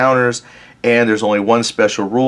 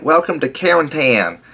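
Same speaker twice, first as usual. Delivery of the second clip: Tennessee Southern accent, pitched higher; 5 semitones higher than usual. Shown as a demonstration. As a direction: greet them like a Tennessee local in a Southern accent. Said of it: "in my best Southern accent".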